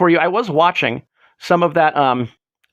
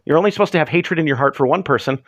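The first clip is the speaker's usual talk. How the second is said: normal talking voice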